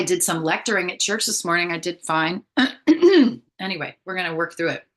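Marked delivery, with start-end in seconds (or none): 2.5-3.4 hard throat clear; loud throat clearing. Real, not acted. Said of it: "I'm losing my voice today".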